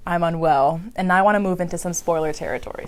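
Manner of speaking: ordinary talk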